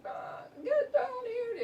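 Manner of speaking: long, high drawn-out yell up the stairs; mother bellowing up the stairs to ignoring kids, hammed-up; god-awful yell. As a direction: stretch it out like a mom calling up three floors, laughing. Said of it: "I'd hear her yell up the stairs, this god-awful".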